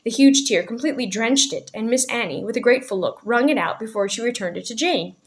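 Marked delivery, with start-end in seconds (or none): none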